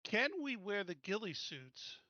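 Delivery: normal talking voice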